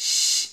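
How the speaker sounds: শ as a long voiceless hiss. Shown as a demonstration. Teacher models the sound of shin, hissing শ. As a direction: let only air out on a sustained শ. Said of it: "এই ধরনের একটা শিস বের করবেন".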